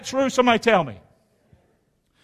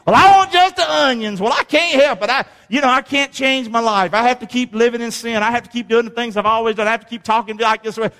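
mock excuse-maker; 3 semitones higher than usual. Preacher mocking a stubborn sinner's excuses, hammed up. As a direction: parody the complainer, speeding up.

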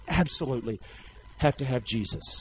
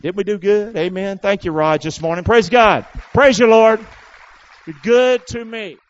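loud, raised closing praise; exultant praise; 4 semitones higher, 10 dB louder than usual. Real, not acted. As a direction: call out praise to God.

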